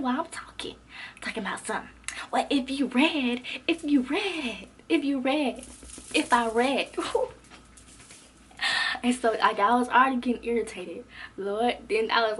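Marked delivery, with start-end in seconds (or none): 2.3-6.9 slow, drawn-out mocking mimicry; mocking classmate imitation. Teacher's pet heckles from behind, mockingly imitated. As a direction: drag out each repeat to mock her. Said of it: "the little teacher's assistant in the back behind me talking".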